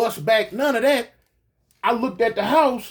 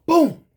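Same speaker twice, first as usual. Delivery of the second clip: loud, higher vocal boom; imitated impact boom; 8 semitones higher, 5 dB louder than usual. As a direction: punch out the sound of a bullet hitting.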